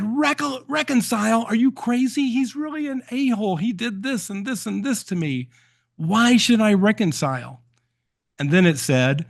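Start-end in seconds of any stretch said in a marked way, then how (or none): 0.0-7.6 high, indignant, incredulous retort; hammed-up fury at the inner self; mock outraged. Retelling a dreamer snapping at her inner voice, mock outraged. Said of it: "that really pissed her off".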